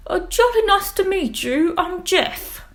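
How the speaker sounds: put-on high, loud plummy English accent; jolly upper-class English cheer; posh British squid. Jeff greeting Squiddy in a hammed-up posh English accent.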